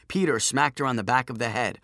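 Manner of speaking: plain reading voice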